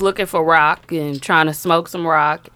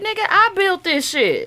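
mock boss; 9 semitones higher than usual. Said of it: "He said".

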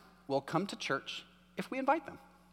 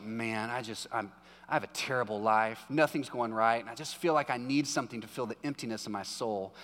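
low, soft moping complaint; mock miserable friend; 8 semitones lower, 4 dB quieter than usual. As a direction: play a gloomy friend sighing it out.

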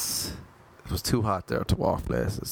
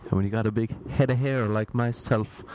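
ordinary talk

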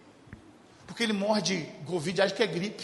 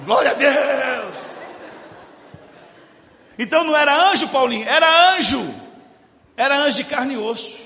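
much higher and louder, proclaiming; loud preaching exclamation; 5 semitones higher, 9 dB louder than usual. Acted. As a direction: shout it to the congregation. (proclamação alta de pregador)

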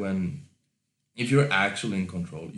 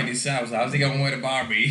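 playful put-on Aussie voice; Australian accent; 3 semitones higher, faster than usual. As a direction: tease in broad Australian. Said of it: "that's the Australian way of saying".

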